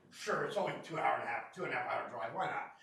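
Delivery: low, quiet, dry sarcasm; deadpan sarcasm. Retold or imitated. To the store manager at four in the morning, dry sarcastic agreement. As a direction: agree like it's obviously absurd.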